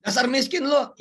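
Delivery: high, loud teasing jeer; teasing a friend about his looks; mock taunt. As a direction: needle him with a shouted tease. Mock insult in dialogue.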